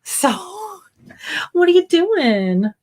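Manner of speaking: high, slow cooing at the dog. Real, not acted. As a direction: coo it like to a puppy.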